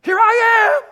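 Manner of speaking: high, loud, drawn-out grand entrance; self-centered announcement. Acted. Self-important person announcing to the room, loud boast. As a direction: trumpet your own arrival, loud and high. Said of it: "walk into the room and announce".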